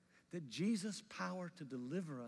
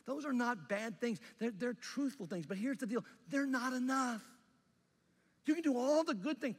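Paul preaching loudly; 2 semitones higher, 5 dB louder than usual. Retold or imitated. Pastor voicing Paul to the Galatians, loud impassioned preaching. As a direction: preach it hard like the apostle warning his church. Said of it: "what Paul's telling the church in Galatia is".

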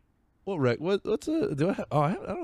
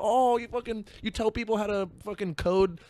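high, sneering disbelief; sarcastic mockery; 10 semitones higher than usual. Mocking a date's job title, high and scornful. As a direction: scoff at his job, pitched high. Mocking small talk on a date.

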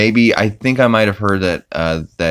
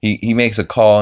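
ordinary delivery

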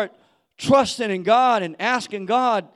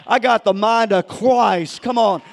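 loud, rising preached claim; preacher climbing again, slower at the end; preaching at full force; 3 semitones higher, 8 dB louder, slower than usual. Acted. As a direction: build back up to a shout.

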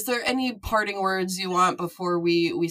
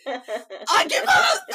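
laughing; 8 semitones higher, 3 dB louder, much slower than usual. Real, not acted. Quiz answer wrong again.

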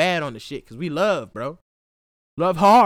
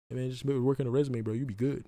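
mild imitation of a friend's advice; 4 semitones lower, 10 dB quieter than usual.

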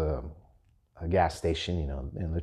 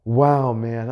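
loud, higher-pitched mock indignation; retold anger; 4 semitones higher, 7 dB louder than usual. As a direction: act out being fed up with a friend. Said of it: "I was really angry".

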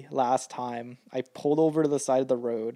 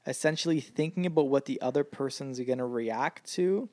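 usual speaking voice

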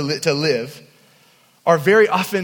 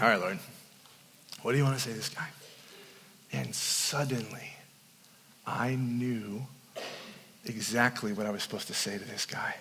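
hushed prayer; 9 semitones lower, 14 dB quieter than usual. Real, not acted. Preacher recalling a quiet prayer, hushed and reverent. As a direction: drop to a hushed, reverent hush.

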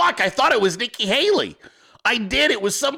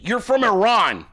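ordinary talk, nothing marked

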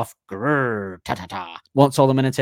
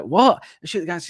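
shocked wife; 8 semitones higher, 4 dB louder than usual. Retold or imitated. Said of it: "She's like".

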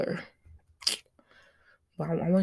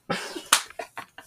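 breathy laugh. Real, not acted. Laughs after joke.

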